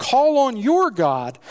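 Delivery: captain begging Jonah to pray, urgent; pleading captain. Acted. Reading captain's plea.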